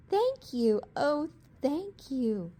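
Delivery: high, heartfelt thanks; grateful cry. A narrator's reading. Witch gratefully thanking her rescuers. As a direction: pour out your thanks. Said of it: "the grateful witch cried".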